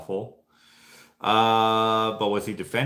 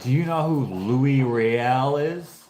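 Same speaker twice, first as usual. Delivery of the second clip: imitating the boss, drawn-out slow question; old boss; 3 semitones higher, 4 dB louder, slower than usual. Old boss quizzing an employee about Louis Riel, drawn-out imitation. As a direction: mimic a boss posing a loaded, slow question. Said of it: "I remember my old boss always said".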